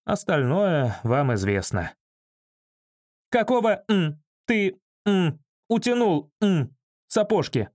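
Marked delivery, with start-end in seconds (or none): 3.3-7.7 high-pitched indignant scolding; bleeped-out irate outburst, pitched up; angry yelling woman. A narrator's reading. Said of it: "орала Валентина".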